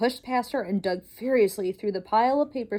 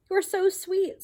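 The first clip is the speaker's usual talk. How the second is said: high, gentle princess voice; sweet princess; 9 semitones higher than usual. Acted. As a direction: coo it like a charmed princess. Princess speaks to mouse.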